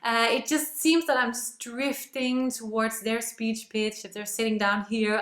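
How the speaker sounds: ordinary talk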